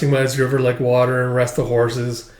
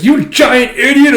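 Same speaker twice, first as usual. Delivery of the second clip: loud, high, drawn-out insult; angry scolding; 11 semitones higher, 8 dB louder than usual. Retold or imitated. Companion punching him for his foolishness, angry. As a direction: explode at him in mock fury.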